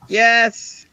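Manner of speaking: high, loud, drawn-out yes; emphatic praise. Real, not acted. Affirming God's goodness, high jubilant yes.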